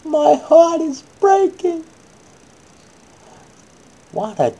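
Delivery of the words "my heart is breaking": high, loud, slow cry of a grieving father; hammed-up wailing father; anguished cry. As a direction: wail it like a father in despair. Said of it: "he cried out".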